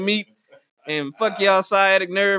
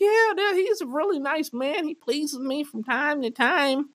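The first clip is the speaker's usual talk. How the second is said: put-on high voice; 5 semitones higher, slower than usual. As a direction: coo it like a shy boyfriend. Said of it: "And he was like".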